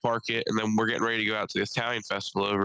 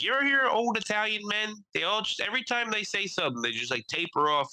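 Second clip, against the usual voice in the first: high-pitched comic Italian storyteller; Sebastian Maniscalco impression; 11 semitones higher than usual. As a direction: mimic his stand-up voice, pitched way up. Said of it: "one joke where he's like".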